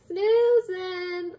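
high, loud, stretched SNOOZING; drawn-out, high-pitched "Snoozing"; playful exclamation. Real, not acted. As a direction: sing out "Snoozing" high and long. Playful repeat of the word.

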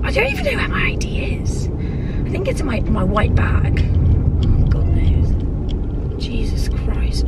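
unvoiced muttered whisper. Real, not acted. Driving alone, whispering complaints to herself. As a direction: mutter it under your breath.

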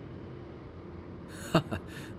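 quiet breathy laugh, brushing it off; soft laugh. Acted. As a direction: laugh it off softly. Brushing off the compliment.

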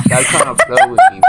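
squeaky falsetto whine; mock crying falsetto to a flirting stranger. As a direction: whine it way up high like a fake cry.

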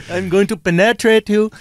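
formal-worded Indian-accented come-on; sexy Indian accent. Shown as a demonstration. Dirty talk that cracks friends up, Indian accent. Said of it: "sexy talk in an Indian accent".

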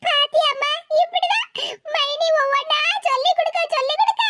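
whimpering tattletale with fake tears; crocodile tears. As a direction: complain through put-on sobs. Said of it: "நீலி கண்ணீர் வடிக்க".

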